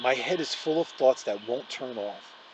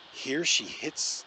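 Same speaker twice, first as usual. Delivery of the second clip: usual speaking voice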